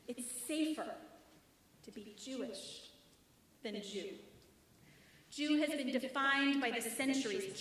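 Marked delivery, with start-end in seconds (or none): 0.1-4.1 much softer and lower, almost a whisper; hushed, heavy admission. Acted. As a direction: let it sink to a hush. Grave closing point of the poem.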